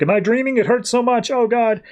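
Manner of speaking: high, panicked cries of pain; panicked boy. Retold or imitated. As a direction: squeal it out like a feverish kid in terror. Feverish boy freaking out.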